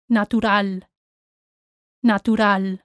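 Natural as na-too-RAL; plain T, no ch, in Natural; Spanish T. Shown as a demonstration. Teacher models for learners, Natural with Spanish T. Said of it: "like any other Spanish T".

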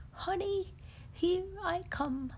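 high, happy bear voice, sing-song; cheerful honey-hunting bear; joyful Pooh. Acted. Pooh sets out for honey in a happy high voice. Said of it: "joyfully humming a tune".

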